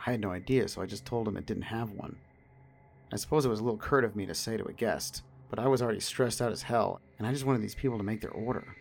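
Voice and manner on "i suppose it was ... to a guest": ordinary delivery, nothing marked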